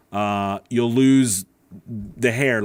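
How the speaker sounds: normal talking voice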